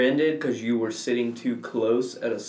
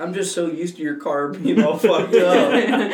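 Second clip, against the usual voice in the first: retold line breaking up into laughter; slow it slightly and crack up, louder by the end; laughing; 4 semitones higher, 5 dB louder than usual. Friend quoting her about the battered car, laughing.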